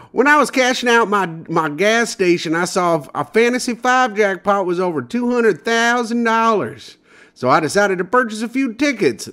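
high, put-on small-town man voice; Michigan lottery winner impression. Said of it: "I'll do my impression of what I think he sounds like".

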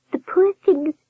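very high falsetto, only mock-secretive; confiding high nurse. A narrator's reading. Nurse sharing concern, high confiding warble. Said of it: "she whispered".

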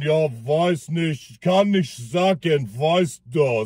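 put-on low, slow, evasive Russian accent; stalling the question in a drunk Russian accent. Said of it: "Mit so einem russischen Akzent". (langsamer, tiefer russischer Akzent, ausweichend)

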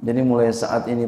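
ordinary talk

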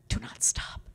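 breathy whispered repeat. Shown as a demonstration. Speaker repeating her plea to the audience in a whisper. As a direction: drop to a bare whisper.

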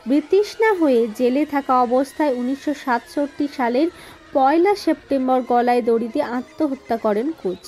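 ordinary delivery

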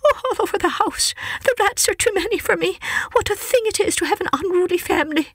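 high, fretful mother cat near tears; mother cat greeting her cousin, high and tearful. Acted. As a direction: plead like a worried mother cat. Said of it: "said Missus Tabitha Twitchit".

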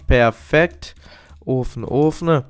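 normal talking voice

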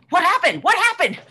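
loud, high outraged yell; outraged shout. Acted. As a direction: yell it at your girlfriend, furious. Shouting after a fall.

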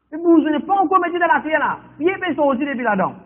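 rising preaching fervour. Real, not acted. Prédication qui monte.

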